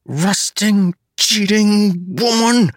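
high, drawn-out indignant curse; exasperated curse. A narrator's reading. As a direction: curse the cheat, stretching it out. Character curses his cheating foe.

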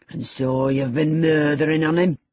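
loud, shocked Yorkshire servant; exclaiming old servant. Acted. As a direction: cry out in horror. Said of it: "exclaimed Joseph".

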